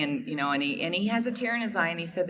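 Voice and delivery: normal talking voice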